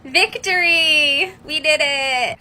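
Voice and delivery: mock victory cry. Mocking the government, a hammed-up triumphant cheer. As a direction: ham up a gloating government cheering. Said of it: "They were like".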